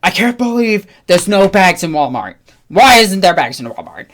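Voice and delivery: loud complaining rant; angry customer. Retold or imitated. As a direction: complain loudly like an entitled shopper. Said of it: "the one person's like".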